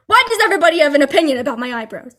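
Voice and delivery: fast New York-New Jersey rant; imitated meatball voice, rapid-fire; meatball Jersey accent. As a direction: rattle it off in an irritated Jersey accent. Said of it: "using my meatball voice".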